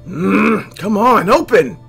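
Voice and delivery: loud, high strained demand; straining yell. Acted. Narrator tugging at a locked drawer, straining yell. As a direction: heave and yell at a stuck drawer.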